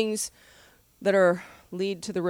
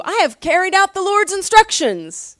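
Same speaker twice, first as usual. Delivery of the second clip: higher and louder, a proud self-satisfied claim; proud boast; 10 semitones higher, 7 dB louder than usual. Acted. Pastor reading Saul's boast to Samuel, proud and loud. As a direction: play Saul proudly claiming he obeyed. Saul boasting in read quote.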